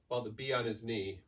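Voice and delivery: usual speaking voice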